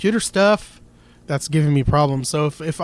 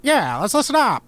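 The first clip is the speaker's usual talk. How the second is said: put-on stagey old-movie Mid-Atlantic accent, higher; 8 semitones higher, 3 dB louder than usual. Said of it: "Mid-Atlantic accent".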